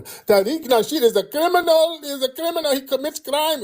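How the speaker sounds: high-pitched crying rant; mocking tearful accuser. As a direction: mimic a man whining out accusations. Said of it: "I think this dude was crying".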